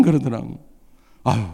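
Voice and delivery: normal talking voice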